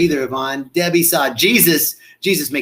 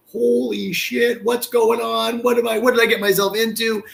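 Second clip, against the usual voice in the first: high, panicked gasp of alarm; 9 semitones higher than usual. Retold or imitated. Guests in ceremony, alarmed and high-pitched. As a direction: guests suddenly scared of what they signed up for. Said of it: "The room is just like".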